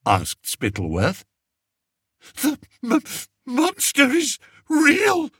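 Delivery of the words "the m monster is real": very high stuttered gulp; gulping fear. A narrator's reading. Frightened king insisting to Spittleworth, gulping. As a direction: gulp it out, shaking. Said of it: "gulped Fred".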